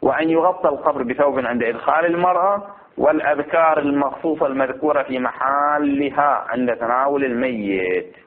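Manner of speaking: deliberate classical recitation, phrase by phrase; measured reading from a legal text; formal text reading. Acted. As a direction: read aloud to a class, stretching the endings. Reads the book text aloud.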